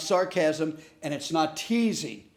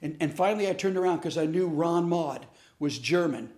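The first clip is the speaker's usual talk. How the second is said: normal talking voice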